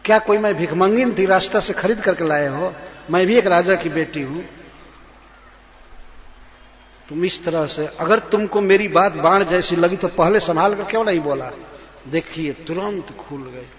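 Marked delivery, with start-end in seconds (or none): 0.0-11.5 loud, fast taunt as Kaikeyi; taunting queen. Acted. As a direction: throw the accusation at the king. (Kaikeyi का तीखा ताना)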